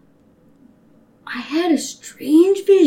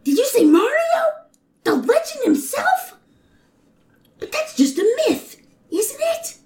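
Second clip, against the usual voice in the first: amazed; 9 semitones higher than usual. Acted. Toadette amazed.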